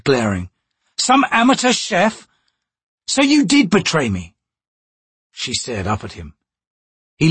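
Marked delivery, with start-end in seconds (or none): 1.0-4.3 put-on indignant young thief. Crocus vs Chalice.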